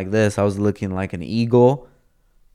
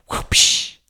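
unvoiced mouth-made whoosh; sudden airy grab effect; imitated whoosh. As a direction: puff out an action-movie swoosh. Imitated sound of grabbing her arm.

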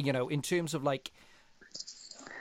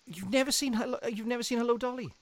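high disbelieving question; can't believe what you hear; incredulous; 7 semitones higher, 5 dB quieter, faster than usual. Real, not acted. Disbelief at never seen film.

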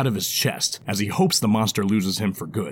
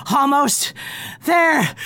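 straining goblin shout; much slower than usual. Acted. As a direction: cry it out while running. Goblin character voice.

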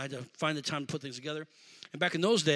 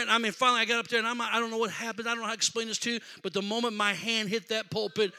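ordinary talk

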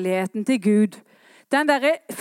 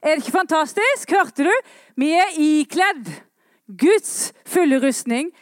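loud, high, rousing call from the pulpit; fiery revival preacher; full-force preaching; 7 semitones higher, 5 dB louder than usual. Acted. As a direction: proclaim it to the whole church, ringing out.

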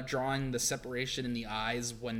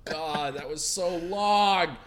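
slow, loud, weary complaint; drawn-out groan; 2 semitones higher, 5 dB louder, slower than usual. Real, not acted. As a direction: stretch it out, exasperated. Groaning about long arc.

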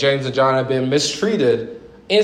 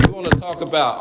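usual speaking voice

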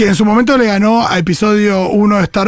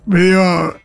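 ordinary delivery

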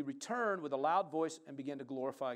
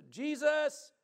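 high, loud cry for attention; lifted voice yelling; 12 semitones higher, 10 dB louder than usual. Retold or imitated. Lepers crying out to Jesus from afar, yelling. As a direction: call out loudly from afar off. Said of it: "they lifted up their voices".

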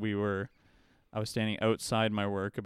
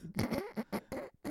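high wheezing laugh; 7 dB quieter than usual. Real, not acted. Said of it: "I'm crying laughing".

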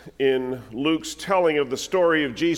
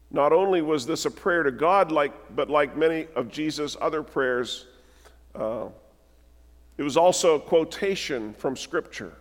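normal talking voice

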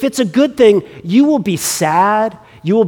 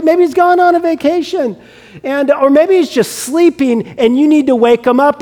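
cheeky mocking; 6 semitones higher than usual. Elijah taunting Baal's prophets, cheeky high mockery. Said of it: "he gets a little cheeky".

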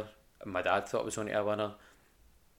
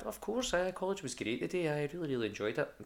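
much higher, sing-song fib that college was great; fake enthusiasm to parents; 7 semitones higher than usual. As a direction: sell the lie brightly and high, easing down by the end. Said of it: "Used to tell my mum and dad".

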